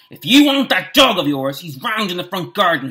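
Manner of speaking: put-on old caretaker's angry shout. A narrator's reading. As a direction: yell at trespassers in a rage. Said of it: "he shouted".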